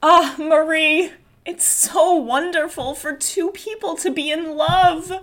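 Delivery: high, drawn-out theatrical lament; slow, swelling character voice; Dora in character. Acted. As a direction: play Dora swooning over love, pitched high. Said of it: "let me get in, back into character".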